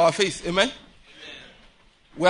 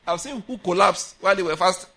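ordinary delivery

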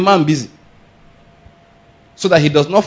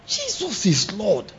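slow, softer exclamation of shock; acted shock; 3 semitones higher, 7 dB quieter, slower than usual. Shown as a demonstration. Preacher illustrates how he expresses shock, hushed astonishment. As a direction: draw it out, as if stunned. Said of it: "that's one of the ways I express my shock".

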